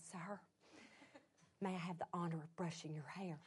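very soft, low, timid request; hushed, hesitant politeness; too quiet to hear. Retold or imitated. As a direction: ask it barely audibly. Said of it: "A little louder".

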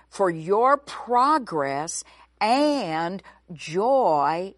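"For your progress and joy" drawn out very slowly; slow right down and lean on every word; slow emphatic answer. Acted. Teacher to Bible students, answering why, very slowly.

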